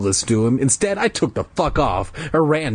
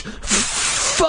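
whispered cry of rage; 10 semitones higher than usual. Acted. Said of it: "I let out a cry of rage".

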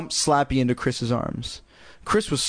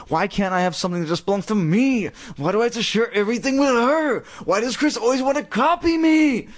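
rising from raised pitch to a high rant; building anger; 9 semitones higher than usual. A narrator's reading. Girl fuming about sharing with her sister, raging. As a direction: build to a high, frustrated outburst. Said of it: "waited for her anger to fade".

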